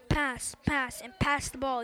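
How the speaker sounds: usual speaking voice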